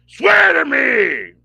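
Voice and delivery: slow, loud, high mock interrogation yell; hammed-up caped hero roar; Batman shout. Streamer mocks Bale's Batman interrogating, slow loud yell. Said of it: "and the Bat voice get, gets on my fucking nerves".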